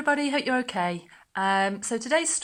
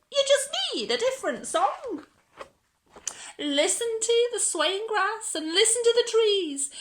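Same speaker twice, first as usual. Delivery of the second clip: much higher, quick gentle cricket; 11 semitones higher than usual. Acted. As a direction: coax him kindly in a tiny voice. Cricket advises Gerald.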